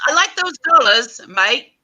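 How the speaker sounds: high, bright-pitched mock Australian accent; playful attempt at an Australian accent. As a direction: try out a cartoon Australian accent. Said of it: "practice my Australian".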